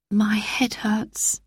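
breathy hushed whisper, slow. Acted. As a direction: whisper it slowly, hiding the hurt. Daughter hiding her distress.

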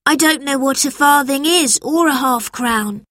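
put-on high-pitched boy's protest; puzzled schoolboy. A narrator's reading. Robert does not know old coins, high puzzled voice. Boy explains ignorance to teacher.

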